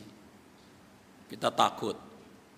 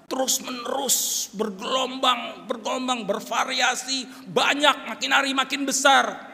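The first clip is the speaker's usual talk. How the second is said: high, drawn-out swell rising to a shout; mounting intensity; 9 semitones higher, 4 dB louder, slower than usual. Shown as a demonstration. Preacher describing troubles growing daily, swelling oratory. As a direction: let each word surge like waves.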